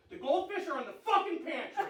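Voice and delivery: a dad snapping, high and fed up; exasperated dad. Retold or imitated. Dad fed up with his literal daughter, high exasperated outburst. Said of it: "And I said".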